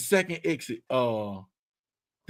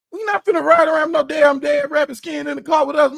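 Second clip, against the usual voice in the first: higher, louder mock protest; retold protest; 8 semitones higher, 5 dB louder than usual. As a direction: push back hard in disbelief. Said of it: "I'm like".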